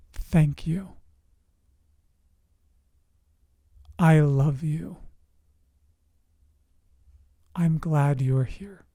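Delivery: slow, tender and hushed; quiet, loving inner voice, unhurried; still, small voice. Acted. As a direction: say it gently, with long pauses between phrases. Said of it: "a very still, small voice that was powerful".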